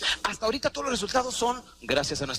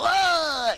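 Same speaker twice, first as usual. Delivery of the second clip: high, louder shocked cry; disgusted gasp; 11 semitones higher, 5 dB louder than usual. Retold or imitated. The body choking on the first cigarette, shocked gasp. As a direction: recoil with a sharp ah. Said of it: "No, dijo".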